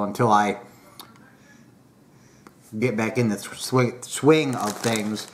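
talking around pipe stem; speaking while exhaling smoke; pipe in mouth. Real, not acted. Seen 1: Pipe out while exhaling smoke, returns to lips from 1.8s.

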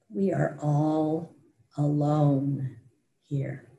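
lifeless, drained delivery, slow; dull voice. Retold or imitated. A survivor in the ruins answers her, slow and dull. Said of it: "his voice just came out dull".

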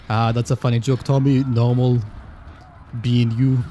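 normal talking voice

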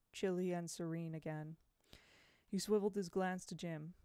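plain reading voice

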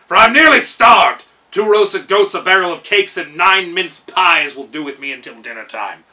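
loud king's order; commanding. A narrator's reading. Gnome king ordering food from his steward, loud command. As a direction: bark it as a royal command. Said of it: "commanded the king".

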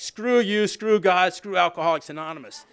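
retelling a grumpy greeter's rude reply, hammed up; grumpy brush-off. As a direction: imitate the greeter's cranky outburst. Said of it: "He's like".

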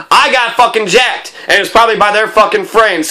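usual speaking voice

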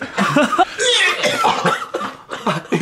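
high squealing laughter; high helpless laugh. Real, not acted. Friends gagging on fruit.

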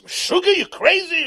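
high, loud cry of alarm; shocked shout. Acted. Man facing the knife shouts in alarm. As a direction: yell it like he can't believe it.

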